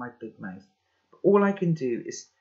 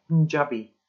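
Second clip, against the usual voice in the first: attempted Indian accent, a bit louder; a rough go at an Indian accent; 7 dB louder than usual. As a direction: lightly imitate an Indian accent. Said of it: "in a really Indian accent".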